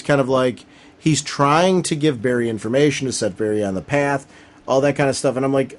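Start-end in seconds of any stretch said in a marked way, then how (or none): none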